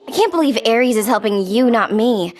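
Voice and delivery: normal talking voice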